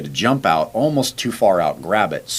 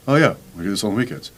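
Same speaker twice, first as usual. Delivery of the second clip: body agreeing; 3 semitones higher, faster than usual. Retold or imitated.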